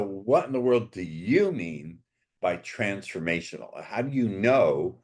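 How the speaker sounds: normal talking voice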